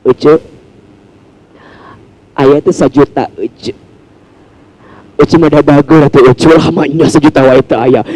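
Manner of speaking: lightly voiced coaxing mum; mother coaxing child. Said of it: "Jadi kayak Bunda minta gitu".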